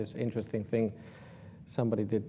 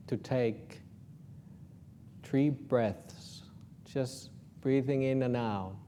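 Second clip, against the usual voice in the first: guided meditation; 8 dB quieter than usual. Acted.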